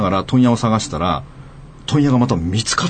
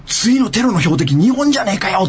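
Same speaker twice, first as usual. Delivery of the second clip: mild mock blurt; 7 semitones higher than usual. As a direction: blurt it out without thinking. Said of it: "思わず言っちゃったんだよ、でかい声で".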